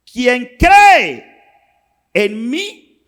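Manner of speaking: high, loud, slowed proclamation; preacher voicing Jesus crying out to the thirsty crowd; Jesus shouting. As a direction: call out to everyone who is thirsty. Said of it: "Jesús tiene que gritar".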